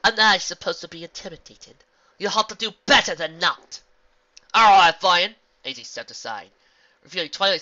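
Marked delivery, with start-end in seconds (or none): 0.0-3.8 loud, higher scornful taunt; mock their feeble threat; mocking villain. Villain mocks heroes. 4.5-5.4 confident. Acted. Hero unveils surprise.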